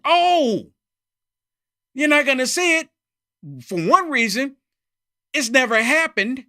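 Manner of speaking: loud, high scoff; scoffing exclamation. Real, not acted. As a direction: throw it out like it's obvious. Scoffing at the absent video.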